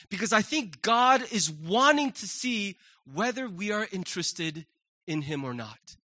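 ordinary talk, nothing marked

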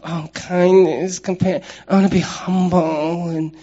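slow, sneering mock of soft virtues; a preacher mocking the tough guy's view of kindness; mocking sissy voice. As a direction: scoff at it like it's weak. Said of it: "That sounds like sissy stuff".

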